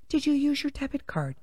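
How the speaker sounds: quiet mocking imitation of the agent. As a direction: repeat her question in a soft put-on voice. Mocking bank agent's question.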